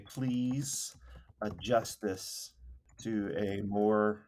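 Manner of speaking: slow dictation. Real, not acted. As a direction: dictate it piece by piece as you type. Dictating a prompt while typing it.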